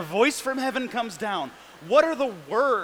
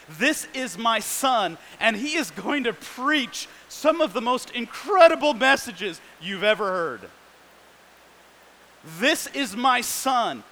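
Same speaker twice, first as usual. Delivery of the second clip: proud proclaiming Father; 2 semitones higher than usual. Retold or imitated. Preacher voicing God as he imagines him, proudly proclaiming. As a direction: announce the son's great deeds with pride. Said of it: "I could imagine what the Father would say is".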